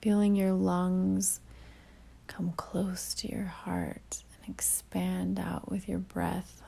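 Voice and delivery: calm meditation delivery with long pauses; guided meditation. Real, not acted. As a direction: ease the listener inward, leaving long silences between phrases.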